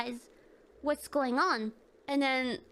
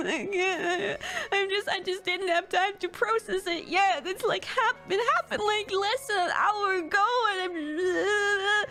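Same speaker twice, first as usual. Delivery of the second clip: higher, mock-tearful babble; retold crying; 5 semitones higher than usual. Client telling the therapist why she is upset, mock crying. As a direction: re-enact explaining through sobs. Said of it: "I broke out in tears".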